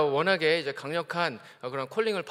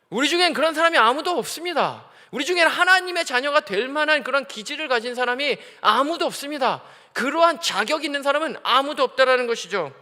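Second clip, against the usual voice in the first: louder, driving sermon delivery; fervent preaching; 6 semitones higher, 6 dB louder than usual. Acted. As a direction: preach it with rising force to the congregation. Preaching climax.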